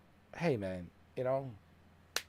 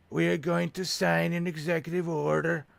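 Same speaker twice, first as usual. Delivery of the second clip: presidential impression; 6 semitones higher, 3 dB louder than usual. Political impression punchline.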